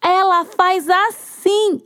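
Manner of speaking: high, slow mocking cockroach; mocking gossip. Cockroach mocks the hen at her party, slow and high. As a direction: sneer at the hen for the crowd. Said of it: "ficava imitando a galinha".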